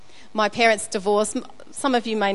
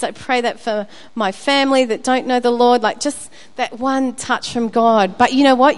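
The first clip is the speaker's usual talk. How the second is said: normal talking voice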